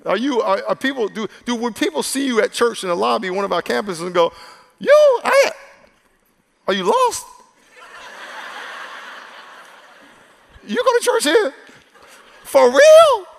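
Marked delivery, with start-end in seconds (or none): 4.8-13.2 mock surprised churchgoer.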